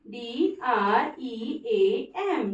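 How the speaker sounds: teacher spelling out D R E A M; slow spelling. Shown as a demonstration. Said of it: "dream".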